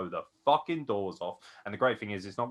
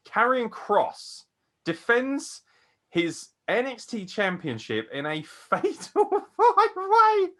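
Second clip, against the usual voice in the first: slow, much higher hype announcement, stretching every word; drawn-out ring announcer build-up; 14 semitones higher, 5 dB louder, slower than usual. Pretend. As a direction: announce it like the main event at a big arena. Announcing main event like ring announcer.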